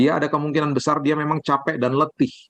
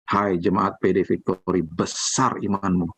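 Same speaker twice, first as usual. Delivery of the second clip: lower, slow praise; a hint of proud Jesus; 6 semitones lower, slower than usual. Jesus praising the congregation's faith, low slow praise. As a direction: speak it slowly, lightly as a pleased Jesus.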